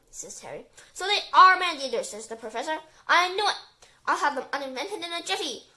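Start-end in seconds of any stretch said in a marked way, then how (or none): 0.9-2.1 loud, high pounce; accusing. A narrator's reading. Professor catches them out about the sharks, accusing. 3.1-5.7 raised, emphatic declaration; triumphant. A narrator's reading. Professor vows to uninvent the sharks, triumphant.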